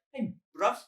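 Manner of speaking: high-pitched mock South African; accidental South African accent. Teasing the co-host's impression, South African accent by accident. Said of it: "I just did South African by accident".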